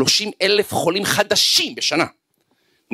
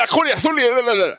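angry complaining; 6 semitones higher than usual. Retold or imitated. Angry student retells his grievances.

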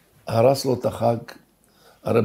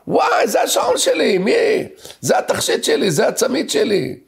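screaming woman; 10 semitones higher than usual. Retold or imitated. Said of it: "פתאום צורחת אחת האנשים".

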